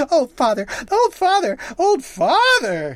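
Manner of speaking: high, loud, building mock scream; raging wife screaming at her husband. A narrator's reading. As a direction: shriek it in rage. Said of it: "she screamed".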